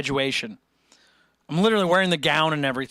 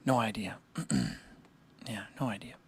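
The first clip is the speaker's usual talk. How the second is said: whispered aside; 7 semitones lower, 10 dB quieter than usual. Real, not acted. Repeats answer under breath.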